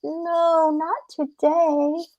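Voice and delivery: small, high, drawn-out plea; pleading rabbit. Acted. Rabbit begs off when caught.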